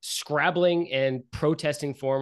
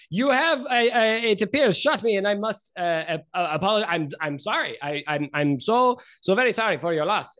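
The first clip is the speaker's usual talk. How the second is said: higher, stammering wealthy nobleman; flustered apologetic nobleman; 4 semitones higher than usual. Acted. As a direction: stumble through an anxious, pleading apology. Game master voices flustered Rainier.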